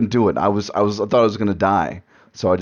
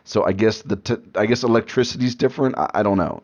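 ordinary delivery

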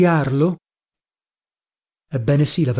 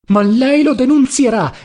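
high, urgent outburst; agitated exclamation; 9 semitones higher, 4 dB louder than usual. A narrator's reading. Adriana leaps to her feet to protest, agitated exclamation. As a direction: exclaim it high, springing into action. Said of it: "esclamò ella levandosi in piedi".

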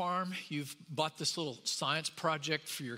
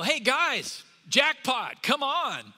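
much higher and louder, calling the crew; excited phone call; 8 semitones higher, 8 dB louder, slower than usual. Pretend. Scout ant on its cell phone calling the others, excited. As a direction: shout the jackpot news to your buddies. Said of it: "They get on their cell phone and go".

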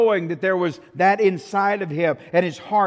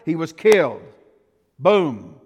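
loud, falling hammer blow; 7 dB louder than usual. Acted. Preacher telling of Uriah's death, loud and final. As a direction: slam it down like a verdict.

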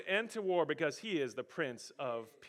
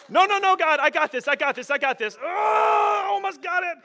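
loud, much higher strained shout; straining refusal; 11 semitones higher, 11 dB louder than usual. Acted. Believer refusing God's help while pulling, loud strained shout. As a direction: heave and insist through the effort.

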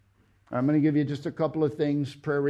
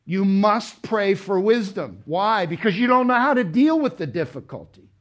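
forceful preaching; 2 semitones higher, 5 dB louder than usual. Real, not acted.

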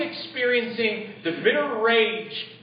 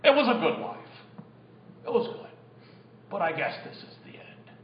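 resigned sigh; 5 semitones lower, 8 dB quieter than usual. Retold or imitated. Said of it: "a fatalist mindset that says".

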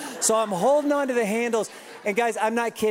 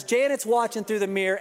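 ordinary talk

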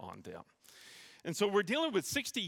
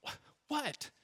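high, soft stammer of disbelief; baffled dad; 11 semitones higher, 4 dB quieter than usual. Retold or imitated. Dad reacting to his son's bite, imitated bafflement. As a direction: imitate a dad lost for words. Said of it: "he's kinda like".

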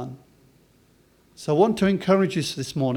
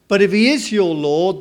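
normal talking voice

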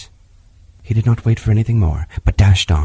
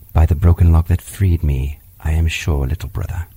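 low panther voice; 7 semitones lower than usual. Acted. Bagheera answers Mowgli.

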